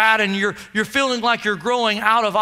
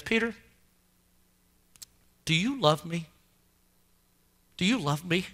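hushed, low and searching; gentle questioning; 7 semitones lower, 9 dB quieter than usual. Acted. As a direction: ask it very softly, leaving space. Jesus gently questions Peter after denial.

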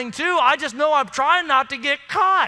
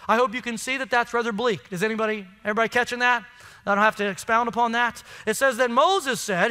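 ordinary talk

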